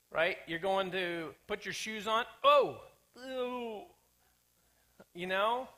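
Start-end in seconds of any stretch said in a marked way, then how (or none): 2.4-2.8 sudden loud startled "Oh!", higher; mock fright, loud and high; startled gasp. Startled putting shoes on. 3.2-3.8 a grossed-out "Ew."; higher, disgusted little "Ew."; mock disgust. Frog found in shoe.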